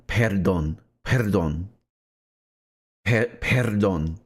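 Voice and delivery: "perdón" with an effortful trilled R before the d; attempted rolled R. Shown as a demonstration. Speaker practicing the tough word, careful rolled-R "perdón". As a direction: try to roll the R in "perdón". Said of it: "let me try and say it".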